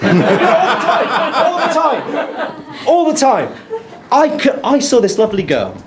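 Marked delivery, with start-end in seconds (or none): 0.0-3.5 high, slow, through laughter; laughing at his own joke, high and drawn out. Real, not acted. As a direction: giggle the words out. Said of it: "he goes like this".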